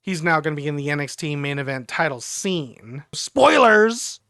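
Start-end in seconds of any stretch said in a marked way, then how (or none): 3.1-4.2 high, loud announcement; raised voice, pitched up; called-out warning. Real, not acted. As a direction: call it out as a warning. Calling out a spoiler warning.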